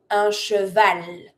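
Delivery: "cheval" slowly, with the final L sounded; pronounced final L. Shown as a demonstration. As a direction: let "cheval" land clearly on its L. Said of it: "You do pronounce the L".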